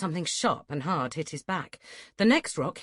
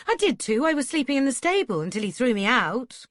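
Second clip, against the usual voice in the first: indignant protest; 6 semitones higher, 6 dB louder than usual. A narrator's reading.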